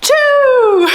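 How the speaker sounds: high, loud triumphant shout; big reveal. Pretend. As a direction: burst out with the answer like a prize announcement. Dramatic reveal after drum roll.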